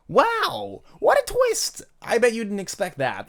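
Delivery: very high, loud fake astonishment; hammed-up squeal at the plot twist; mock amazement. Mock amazement at own ending.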